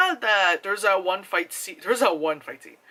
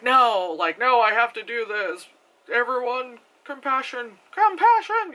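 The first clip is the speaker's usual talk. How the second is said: mock heroine; 5 semitones higher than usual. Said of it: "And then she's like".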